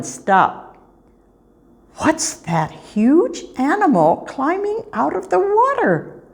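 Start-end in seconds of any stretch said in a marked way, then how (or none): 2.0-6.2 high, wide-eyed kitten question; amazed kitten. Acted. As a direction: gasp at the huge animal. Kitten spots a hippo.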